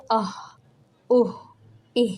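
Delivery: آہ اوہ ای breathed out from the throat; throat vowel demo. Shown as a demonstration. Teacher showing the breath check with fingers. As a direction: let warm air out on آہ اوہ ای. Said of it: "اور جب پھر پڑھو".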